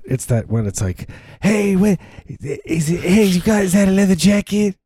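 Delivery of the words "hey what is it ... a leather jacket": high-pitched Cleveland burnout guy accent. Shown as a demonstration. Demonstrating the strange accent to a friend, high Cleveland burnout voice. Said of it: "What's the accent? It's like, it's that".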